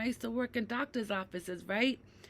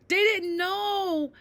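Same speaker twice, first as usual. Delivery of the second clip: slow, higher, louder, each word pressed; slow emphatic; 8 semitones higher, 6 dB louder, much slower than usual. Real, not acted. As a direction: stress that they didn't know. Upset at the yelling patient.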